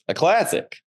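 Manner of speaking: high, dry mock-appraisal of a passerby; comic judging a passerby, high and wry; deadpan verdict. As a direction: pronounce him a classic, high and knowing. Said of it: "stares at him and looks back at us and goes".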